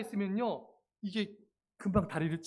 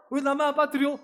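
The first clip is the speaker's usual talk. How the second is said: mock pout, pitched up; sulky complaint; 6 semitones higher, 4 dB louder than usual. Woman teacher left out of the dunking, mock sulk. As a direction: imitate someone miffed at being left out. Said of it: "안 빠트리자 삐집니다".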